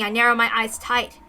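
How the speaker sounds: usual speaking voice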